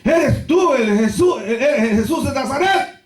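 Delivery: high, drawn-out challenge; demanding guards. Acted. Guards come to arrest Jesus, high demanding question.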